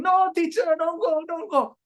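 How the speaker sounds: loud, high mock pleading of schoolchildren; kids crying out. Smart kids beg the teacher not to leave, mock crying. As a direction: imitate kids wailing for teacher to stay. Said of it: "smart kids start crying".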